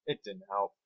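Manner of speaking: ordinary delivery, nothing marked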